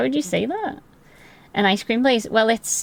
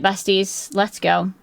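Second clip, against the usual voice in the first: ordinary talk, nothing marked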